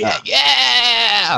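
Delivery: high, drawn-out mimicked yell; mock monster cry. Friend mimicking the transformed villain to his co-host, high drawn-out mock cry. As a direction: stretch it into an exaggerated movie-villain cry. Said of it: "He's like".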